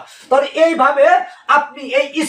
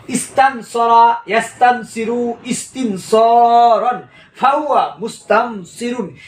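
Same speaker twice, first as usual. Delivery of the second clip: slow, evenly spaced Arabic forms, each word drawn out; slow model recitation; slower than usual. Shown as a demonstration. Teacher modelling the verb forms, slow and deliberate. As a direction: recite slowly so students can follow. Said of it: "তিনটা একসাথে পড়বেন".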